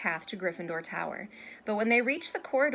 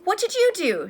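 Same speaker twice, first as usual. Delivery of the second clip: high, louder anxious question; 11 semitones higher, 9 dB louder than usual. A narrator's reading. Hermione asking Harry about his day, anxious. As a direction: ask with worried eagerness. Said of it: "looking anxious".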